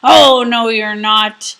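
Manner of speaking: very loud troll shout; troll roaring at the big goat. Acted. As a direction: bellow it like a furious troll. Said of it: "the troll shouted".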